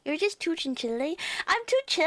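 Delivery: ordinary talk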